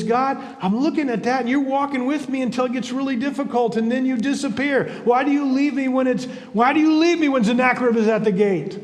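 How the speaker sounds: lightly acted plea, building to the question; man questioning God. Man on the beach asking God why he left, pleading. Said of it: "asking God".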